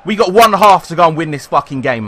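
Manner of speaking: ordinary talk, nothing marked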